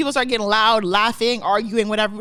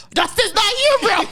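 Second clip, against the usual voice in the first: high, laughing mock defiance; flexing back; 10 semitones higher than usual. Retelling a friend refusing his code name, hammed-up defiance. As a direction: puff it up as the cocky friend. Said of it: "he started flexing back".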